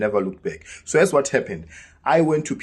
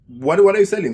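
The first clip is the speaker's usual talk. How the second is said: calling out question; 7 semitones higher, 5 dB louder than usual. Retold or imitated. Customers calling out to him.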